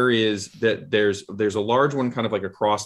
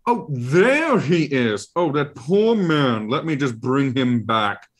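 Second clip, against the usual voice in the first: higher, fussy monster voice musing aloud; Ralakor creature voice; 5 semitones higher, 4 dB louder than usual. Acted. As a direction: muse like a pompous eye creature.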